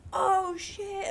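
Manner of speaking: high-pitched imitation of sudden surprise; mock astonished reaction; dawning realization. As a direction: act out the penny dropping, voice jumping up. Coworker realizes the cat's namesake.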